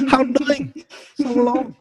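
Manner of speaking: high-pitched, hammed-up Chinese accent; mock Chinese accent. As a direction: parody halting Chinese-accented English. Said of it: "You probably had, like, a thick".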